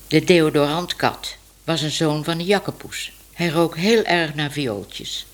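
plain reading voice

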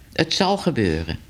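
ordinary delivery, nothing marked

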